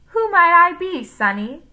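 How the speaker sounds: put-on high, loud old granny cackle; cackling grandmother. A narrator's reading. Paul speaking as a grandmother, high cackling. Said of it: "Paul cackled".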